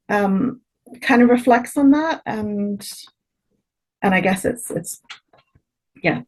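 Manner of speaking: ordinary talk, nothing marked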